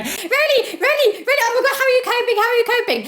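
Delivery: high, squeaky mock concern; hammed-up shrill fan voice. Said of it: "everyone was tweeting me going".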